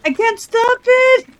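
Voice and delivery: high frantic cry; panicked cry. Real, not acted. Fire out of control.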